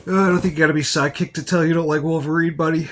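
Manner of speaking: high, loud, fast mocking voice; goofy sidekick voice, quick and loud; put-on character voice. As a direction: rattle it off as a cocky sidekick. Answers in a put-on voice.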